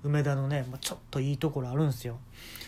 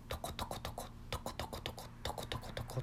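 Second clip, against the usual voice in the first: soft pouring noise; 16 dB quieter than usual. Retold or imitated. Imitating pouring.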